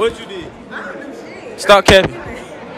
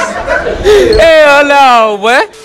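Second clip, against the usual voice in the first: higher, cracking up in disbelief; laughing disbelief; 9 semitones higher, much slower than usual. Real, not acted. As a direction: laugh out the refusal. Host cracks up at answer.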